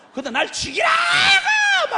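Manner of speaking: hammed-up screaming tantrum, drawn out; tantrum yell. Jonah raging at God to kill him, screaming tantrum. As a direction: rise into a long, very high yell. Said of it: "막 이러고 난리가 났어".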